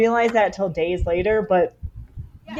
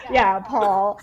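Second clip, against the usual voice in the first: normal talking voice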